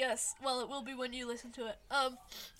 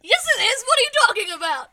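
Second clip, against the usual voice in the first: much louder, higher playful objection; loud protest; 9 semitones higher, 17 dB louder, faster than usual. Real, not acted. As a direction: shout it in mock outrage. Playful protest about presents.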